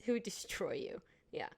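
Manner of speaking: whisper. Acted. Teasing threat, whispered.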